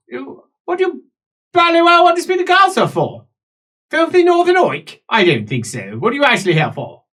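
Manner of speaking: high, loud, snooty guard voice; posh guard. Acted. Gate guard scorning the northern stranger, high snooty voice.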